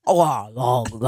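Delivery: low, imitated slurping moans; mock moaning. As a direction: moan it as a mock impression. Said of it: "She's like".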